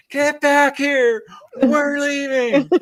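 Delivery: high, slow, pleading mock panic with a laugh; hysterical friend. Scared friend calling her back to the car, high slow mock panic.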